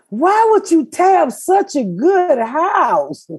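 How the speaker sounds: high, slow mock of a prying neighbor; mocking nosy neighbor. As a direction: imitate the busybody neighbor, up high and drawn out. Neighbors' nosy question voiced.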